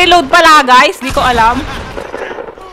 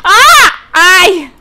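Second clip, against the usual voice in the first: startled scream; 4 dB louder than usual. Real, not acted. Player caught out in game, startled scream. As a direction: scream out, then yelp.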